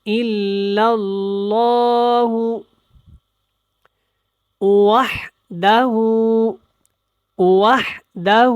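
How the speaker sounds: slow, drawn-out recitation with clear letters; careful tajweed recitation. Shown as a demonstration. Teacher demonstrating the kalima to learners, slow tajweed recitation. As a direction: recite slowly, stretching each word. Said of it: "اور ہو کو لمبا نہیں کرنا".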